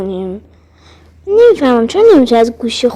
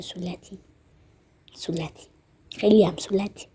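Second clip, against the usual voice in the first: muttered under breath; 6 semitones lower, 14 dB quieter than usual. Real, not acted. Mutters colour name to himself.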